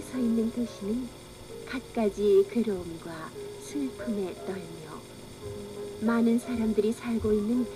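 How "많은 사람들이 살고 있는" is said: ordinary delivery, nothing marked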